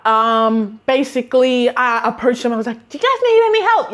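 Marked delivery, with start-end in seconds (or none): 2.9-3.9 high, quick, eager sales-floor chirp; hammed-up jolly shop assistant; jolly greeting. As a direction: greet customers far too cheerfully. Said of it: "my jolly self".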